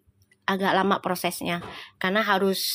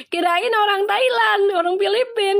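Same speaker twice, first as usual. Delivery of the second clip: mock vendor; 10 semitones higher, 4 dB louder than usual.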